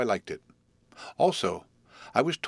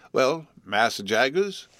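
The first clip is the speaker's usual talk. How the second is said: slow, stuffy, louder; voice of a head cold; 4 semitones higher, 4 dB louder, slower than usual. Acted. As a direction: speak through a constant cold. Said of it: "in the voice of a sufferer from a constitutional cold".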